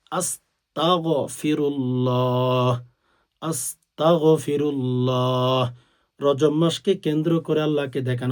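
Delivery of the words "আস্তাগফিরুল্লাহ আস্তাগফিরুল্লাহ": very slow, careful আস্তাগফিরুল্লাহ; correct pronunciation. Shown as a demonstration. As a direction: draw out আস্তাগফিরুল্লাহ reverently. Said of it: "আপনি বলবেন". (ধীরে শুদ্ধ উচ্চারণে আস্তাগফিরুল্লাহ)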